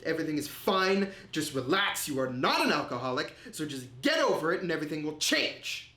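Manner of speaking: emphatic self pep talk, pitch leaping on key words; convincing himself. Retold or imitated. Man telling himself he is not an alcoholic, insistent self-convincing. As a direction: insist on it to talk yourself round. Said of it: "I just convinced myself".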